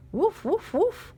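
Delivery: high, loud playful woofs; dog barking. Acted. Reading to a child, mock dog barks. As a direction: bark it out like a small dog. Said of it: "A dog barks somewhere near".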